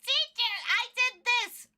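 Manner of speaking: high-pitched imitation of a needy pupil; upset child. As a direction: plead for the teacher's attention in a high kid voice. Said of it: "he gets so sad and upset".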